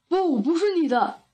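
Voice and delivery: lightly voiced arguing bear; bear character. A narrator's reading. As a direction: object as a bear in a quarrel. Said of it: "熊说".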